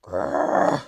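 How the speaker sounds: low whispered growl. Acted. Hamster making his growl face at rivals, low growl. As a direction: growl like a tough racer.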